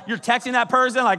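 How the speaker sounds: ordinary talk, nothing marked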